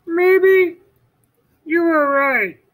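Toad's voice. Acted. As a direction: give in, high and worried. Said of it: "said Toad".

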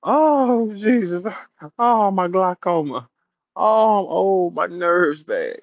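high, loud hammed-up wailing; exaggerated, drawn-out cry of woe; mock distress. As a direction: moan it out long and high.

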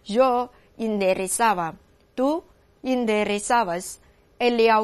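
slow, evenly paced Spanish conjugation drill; model recitation. Shown as a demonstration. Teacher running the conjugation for students, slow even recitation. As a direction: give each form slowly and separately. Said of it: "这个变位再走一遍哈".

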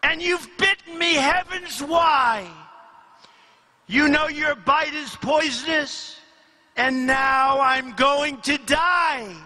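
high-pitched, betrayed protest; dramatic acted lament; anguished cry. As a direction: cry out as the wronged victim. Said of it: "cried the woman".

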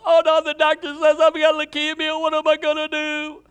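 high, loud hammed-up lament about bad news; mock despair. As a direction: play a panicked patient. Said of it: "I'm not mocking you now".

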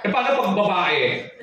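normal talking voice

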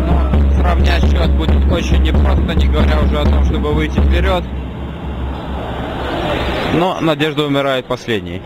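ordinary delivery

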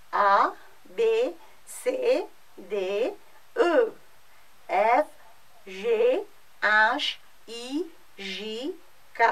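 French letter names, one by one with pauses; French alphabet. Shown as a demonstration. Teacher recites the alphabet for French class, French letter names. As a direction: recite each letter name in French, spaced out. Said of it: "So I'm repeating the alphabet".

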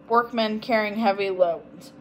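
ordinary delivery

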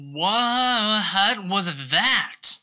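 very drawn-out, startled question; baffled. Real, not acted. Player startled by something in the game, drawn-out bafflement. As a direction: stretch out the first word in disbelief.

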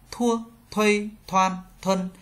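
"Thua, thuây" with a breath after the t; T read as th. Shown as a demonstration. Teacher modelling T for learners, "Thua" with aspirated th. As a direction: drill "thoan, thuân" evenly with aspirated t. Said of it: "Tờ đọc thành thờ".

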